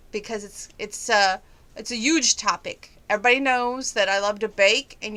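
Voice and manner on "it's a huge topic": ordinary talk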